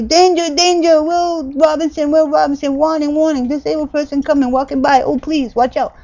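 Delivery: high, loud mock alarm call; hammed-up danger announcement; mock warning. Mimicking a warning to passers-by.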